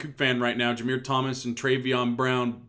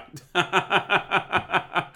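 laugh; 7 semitones higher than usual. Real, not acted. Laughs at own slowness.

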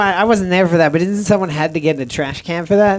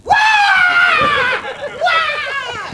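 high-pitched mock scream of agony; imitated dying scream. Imitating Angus screaming from boiling water, high mock scream. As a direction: wail like a man being scalded, hammed up. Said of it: "screams like he's dying".

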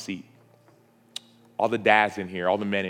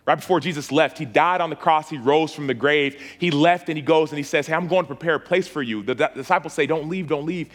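raised, higher preaching voice building with fervour; preaching at full force; 4 semitones higher than usual. Acted. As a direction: preach it out to the whole room, pushing each line. Preaching the ascension story.

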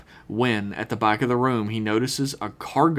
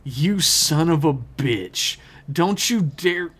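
angry; 6 semitones higher, slower than usual. Acted. Trip confronts Archer in scene.

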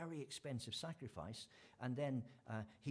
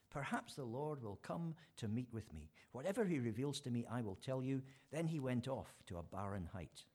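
scripture reading; 5 dB louder than usual. Acted. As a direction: read it out to the congregation with weight. Reads scripture verses aloud.

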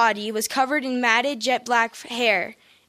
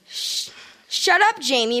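plain reading voice

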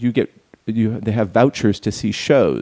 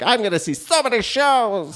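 excited fans; 10 semitones higher than usual. Retold or imitated. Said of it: "They're like".

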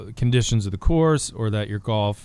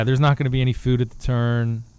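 ordinary delivery

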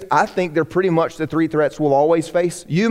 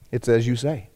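low and much softer; quiet solemn answer; 8 semitones lower, 5 dB quieter than usual. Acted. Jesus answering the question, low and hushed. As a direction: say it low and heavy.

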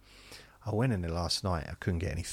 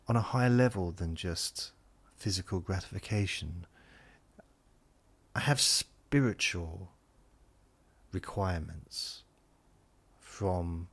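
slow, pompous self-description with long pauses; mock-sophisticated. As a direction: play at being refined, drawing out each phrase. Mock-sophisticated boast about himself.

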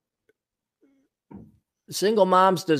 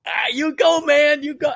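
high-pitched imitated cheer; mock cheering on; 11 semitones higher than usual. Onlooker cheering on a guy he sees through, mock cheering. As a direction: play an impressed onlooker egging him on. Acting out admiring onlookers' response.

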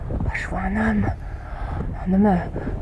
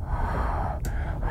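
whispering; 9 dB quieter than usual. Acted. Exploring quietly, awestruck.

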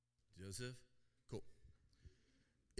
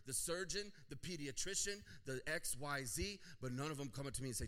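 raised preacher voice, piling up the list; impassioned preaching; 4 semitones higher, 4 dB louder than usual. Real, not acted. Pastor confronting parents over ambitions, impassioned preaching. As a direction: preach it as a pressing challenge.

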